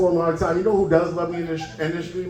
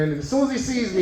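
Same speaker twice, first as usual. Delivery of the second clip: usual speaking voice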